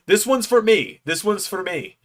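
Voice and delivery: high, insistent wrestler impression; Joe Hendry. Wrestler hands merch guy his phone, impersonated eagerness. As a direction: imitate an eager star, pitched well up. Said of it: "not the best Joe Hendry impression".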